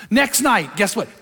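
ordinary talk, nothing marked

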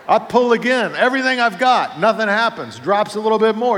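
rising panic as he pulls; frantic. Retold or imitated. Preacher reliving the stuck bar, frantic and loud.

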